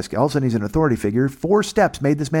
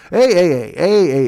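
loud, hammed-up Canadian hey hey; mock Canadian banter; 5 semitones higher, 5 dB louder than usual. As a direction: imitate chummy Canadians.

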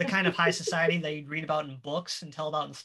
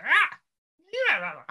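excited goblin cry; 12 semitones higher, 6 dB louder than usual. Acted. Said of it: "they go".

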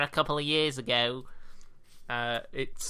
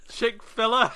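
"filler" said through a laugh; giggling over "chick filler"; laughing at own mistake; 10 semitones higher, 5 dB louder than usual. Retold or imitated. Retelling a Chick-fil-A order mix-up, laughing. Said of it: "I originally called it filler".

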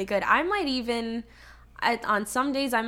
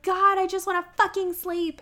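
hedgehog voice; 9 semitones higher than usual. Retold or imitated. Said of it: "She's like".

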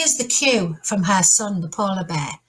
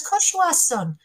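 reassuring granddad; 3 semitones lower than usual. Acted. Granddad answering his grandson, reassuring sing-song. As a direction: reassure the youngster, lilting up at each item. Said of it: "And he says".

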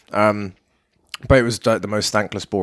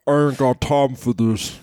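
reverb voice effect; slower than usual. Acted. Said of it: "Crosh Under's voice".

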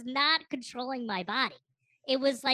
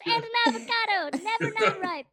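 high, hammed-up Italian-accented Scrooge, laughing; cartoon Italian accent on a giddy Scrooge; joking about the store, in a mock Italian Scrooge voice; 11 semitones higher, 4 dB louder than usual. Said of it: "you always go with the wrong accent every time".

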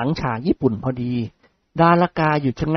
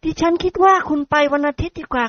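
put-on sweet polite young lady; young woman voice; 11 semitones higher than usual. Model suggests Sunday. (เสียงหญิงสาวสุภาพอ่อนหวาน)

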